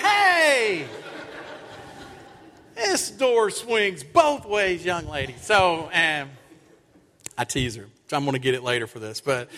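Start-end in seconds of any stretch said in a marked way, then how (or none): 0.0-5.4 teasing. Retold or imitated. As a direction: tease her playfully, drawing it out. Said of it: "and I was like".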